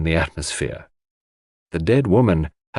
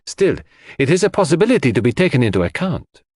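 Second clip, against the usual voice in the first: put-on higher-pitched, animated accented detective; Poirot, reasoning; 6 semitones higher than usual. A narrator's reading. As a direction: reason it through emphatically, point by point.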